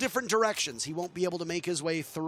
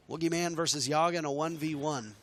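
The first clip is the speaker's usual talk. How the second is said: ordinary talk, nothing marked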